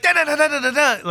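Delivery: high, loud mock-Italian babble; imitating frantic kid. Italian kid bursts in running, frantic mock babble. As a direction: rattle off nonsense syllables like a panicking child. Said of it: "like, freaking out".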